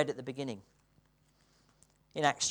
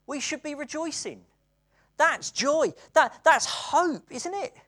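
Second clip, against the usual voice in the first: building to high, loud, joyful proclamation; rising joyful preaching; 7 semitones higher, 7 dB louder, slower than usual. Acted. Preacher exhorting the church to rejoice, rising joyful shout. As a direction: preach it higher and louder with joy.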